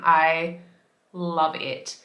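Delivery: ordinary talk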